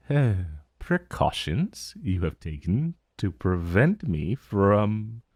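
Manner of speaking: ordinary delivery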